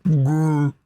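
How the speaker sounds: mock a sullen monster groan; moaning growl. To the players, the creature grumbles a moan. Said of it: "moaning noise".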